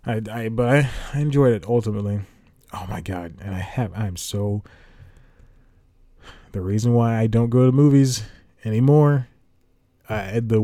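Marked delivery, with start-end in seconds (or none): none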